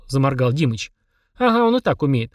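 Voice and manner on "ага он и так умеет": usual speaking voice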